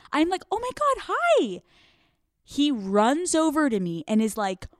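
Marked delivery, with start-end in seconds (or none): 0.5-1.6 very high, fake-delighted squeal; gushing surprise. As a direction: greet him in put-on amazement, way up high. Feigned delight at seeing him.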